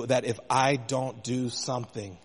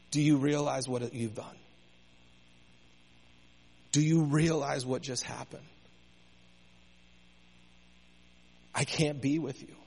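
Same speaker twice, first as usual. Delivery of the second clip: hushed, grieving God without anger; a quiet, heartbroken question, not a rebuke; 5 dB quieter than usual. Retold or imitated. God asks Eve softly, grieved rather than angry. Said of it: "it's not anger".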